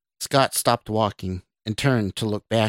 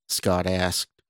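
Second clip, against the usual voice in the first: ordinary delivery, nothing marked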